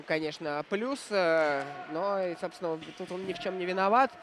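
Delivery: ordinary talk, nothing marked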